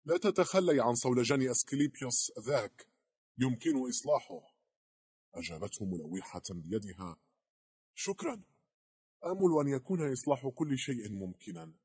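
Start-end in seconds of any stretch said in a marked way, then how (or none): 0.1-4.6 loud, high call from behind; calling after her. A narrator's reading. Langdon shouts after the departing director, calling out. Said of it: "ناداها لانغدون من خلفها". 7.9-11.7 high, loud reply over distance; calling back. A narrator's reading. Departing director answers Langdon with a wave, calling back. Calls back while walking away, waving.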